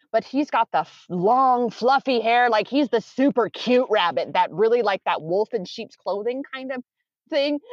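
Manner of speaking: ordinary talk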